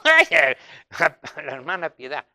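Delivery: ordinary talk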